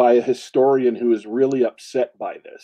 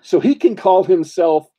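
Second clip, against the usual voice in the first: ordinary talk, nothing marked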